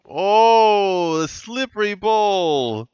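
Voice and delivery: high, loud groan of dismay; dismayed cry. Real, not acted. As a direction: wince out loud at the fumble. Dropped mark on a wet night.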